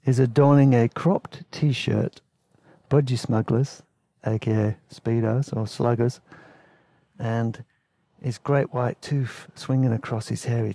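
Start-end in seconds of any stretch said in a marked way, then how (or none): none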